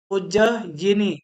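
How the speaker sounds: उज्जयिनी drawn out syllable by syllable, very slow; slow spelled-out word. Shown as a demonstration. Teacher slowly sounds out उज्जयिनी for students. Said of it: "उज्जैनी में आधा ज".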